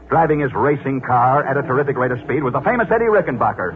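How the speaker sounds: rising, building story narration; dramatic radio narrator. Acted. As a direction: build the tension toward the crash.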